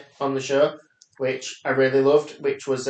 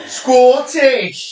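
loud, high hammed-up Scottish shout of the word; mock Scottish accent; 8 semitones higher, 8 dB louder than usual. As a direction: bellow "Scottish!" in a terrible fake Scottish accent. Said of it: "That's my Scottish accent".

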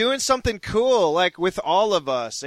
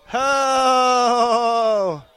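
ecstatic cry; 5 semitones higher, 5 dB louder than usual. Real, not acted. Charismatic worship outburst.

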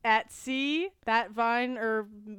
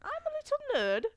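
much higher, prissy posh voice; posh nerd voice; 8 semitones higher than usual. Retold or imitated. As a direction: play a prim little posh nerd. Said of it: "I feel like I'm like".

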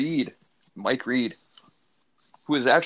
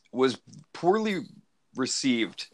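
ordinary delivery

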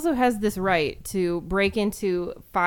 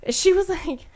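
normal talking voice

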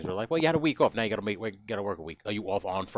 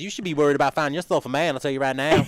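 higher, louder mock Southern lecture; scolding Southerner; 6 semitones higher, 7 dB louder than usual. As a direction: meddle like a pushy Southern relative. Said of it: "someone from down south says".

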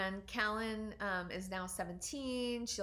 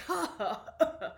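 high, loud sarcastic ha ha ha; hammed-up hurt laugh; mock laugh; 8 semitones higher, 7 dB louder than usual. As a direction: laugh off the sucker punch. Stung by daughter's jab.

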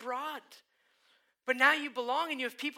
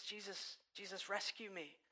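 soft, hushed plea to Jesus; quiet desperate plea; 13 dB quieter than usual. Retold or imitated. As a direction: pray it almost under your breath. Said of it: "we turn back to Jesus".

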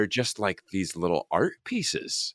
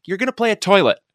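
high, loud blurting out the news; blurted reveal; 14 semitones higher, 8 dB louder, faster than usual. Retold or imitated. Director finally tells the actor her role, blurted. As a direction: blurt it out all at once. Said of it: "She's like".

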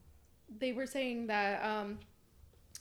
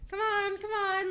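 waving people in; 10 semitones higher, 8 dB louder than usual. Retold or imitated. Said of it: "they're just like".

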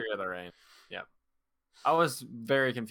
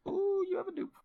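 surprised. Real, not acted. Reacting to a clever point.

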